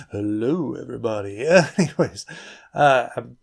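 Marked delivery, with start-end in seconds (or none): 0.1-1.5 low voice. Acted. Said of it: "a low voice to".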